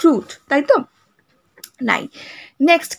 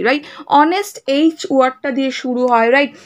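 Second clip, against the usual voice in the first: usual speaking voice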